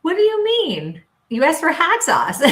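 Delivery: laughing impression of a confused friend; Tom, puzzled. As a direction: play a baffled friend through laughs. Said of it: "and goes".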